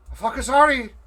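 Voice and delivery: loud, high desperate yell; shouted apology. Acted. Gortek yelling sorry at the innkeeper. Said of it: "And he's like".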